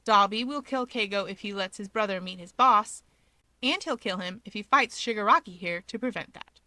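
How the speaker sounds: ordinary delivery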